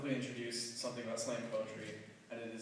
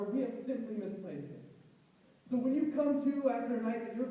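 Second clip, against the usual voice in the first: slam poem recitation; 13 semitones higher, 7 dB louder than usual. Acted.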